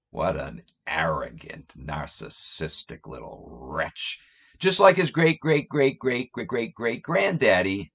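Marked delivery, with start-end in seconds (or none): 0.1-4.2 low, whispered sneer; contemptuous whisper. Real, not acted. Insulting Jesus to listeners in a scornful whisper. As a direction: hiss the insult under your breath. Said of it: "contemptuous aside".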